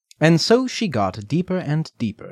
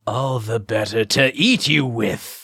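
wolf voice. Acted. Wolf reveals itself.

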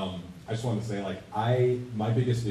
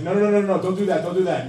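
director's protest; 8 semitones higher, 8 dB louder than usual. Retold or imitated. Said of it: "Jonathan's like".

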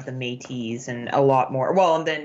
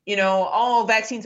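higher, louder mocking voice of a vaccine skeptic; mimicking people who ask dumb questions about Canada, higher and louder; mock clueless skeptic; 7 semitones higher, 5 dB louder than usual. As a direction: ham up a dim know-it-all for laughs. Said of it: "or my favorite is".

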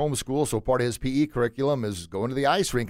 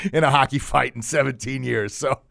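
laughing. Real, not acted. Laughing through the line.

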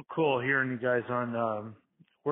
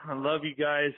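ordinary talk

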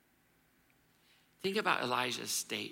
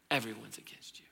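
breathy whisper, hissing the lie; 3 semitones lower, 4 dB quieter than usual. Acted. The lie whispering to Elijah. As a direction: whisper it like a lie in his ear.

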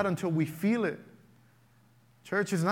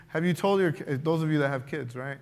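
normal talking voice

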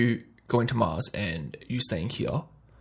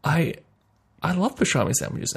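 mock eager; 7 semitones higher than usual. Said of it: "And you're like".

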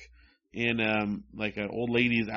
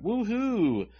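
high, drawn-out teasing calls; mock catcalling yell; 6 semitones higher, slower than usual. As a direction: taunt in a sing-song falsely sweet call. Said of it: "the boys are yelling out".

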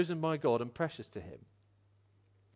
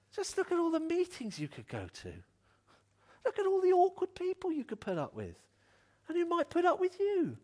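higher and higher, a hammed-up cheery sales pitch; mock church pitch; 10 semitones higher than usual. Preacher mocking a wrong view of church, in a sarcastic cheery voice. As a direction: oversell the chores of church with irony. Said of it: "It doesn't say".

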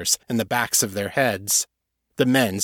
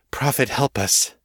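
panting; 3 semitones higher than usual. Acted. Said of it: "Kate panted".

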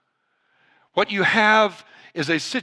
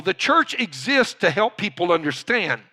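ordinary delivery

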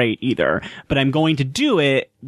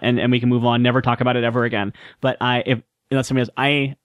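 usual speaking voice

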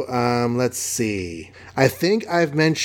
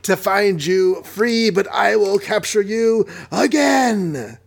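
villain voice; 7 semitones higher, slower than usual. Acted. Villain Mordo taunting.

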